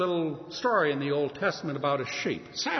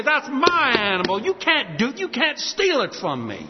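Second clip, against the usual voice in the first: high, loud indignation, acted out; angry protest; 9 semitones higher, 6 dB louder than usual. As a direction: protest angrily like a robbed pet owner. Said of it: "You'd be angry".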